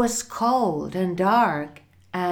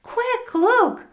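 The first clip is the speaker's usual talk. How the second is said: high, raised, urgent cry; excited shout; 7 semitones higher, 6 dB louder than usual. A narrator's reading. Mother calls father to see the horse, shouting excitedly. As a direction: call out in astonishment. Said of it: "she shouted".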